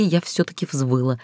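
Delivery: plain reading voice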